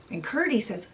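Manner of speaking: ordinary delivery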